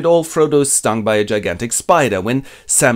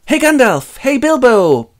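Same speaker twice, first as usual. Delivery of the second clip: higher and louder mock call; 12 semitones higher, 7 dB louder, slower than usual. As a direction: hail friends leaving across a distance. Sam calls after them.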